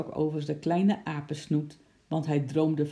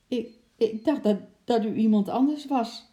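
higher, louder stuttering excuse; 7 semitones higher, 5 dB louder than usual. Acted. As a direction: stammer out the excuse in alarm. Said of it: "stotterde Bart".

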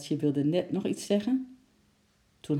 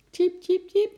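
very high squeaky tjiep; squirrel squeak; 7 dB louder than usual. A narrator's reading. Little squirrel Eki calls out lonely, high squeaky peep. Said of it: "piepte Eki met een scheef kopje".

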